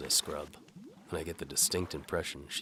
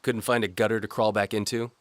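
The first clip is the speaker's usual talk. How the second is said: louder, higher sneering guard; spitting contempt; 6 semitones higher, 8 dB louder than usual. Acted. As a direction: spit the words at him. Guard insults narrator.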